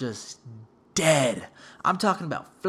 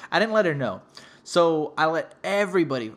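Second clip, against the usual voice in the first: normal talking voice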